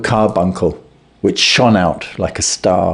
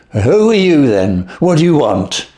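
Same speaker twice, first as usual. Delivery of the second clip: frightened, pitched-up questions; quavering voice; 6 semitones higher than usual. Acted. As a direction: ask it shaken and scared, voice climbing. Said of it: "he asked in a quavering voice".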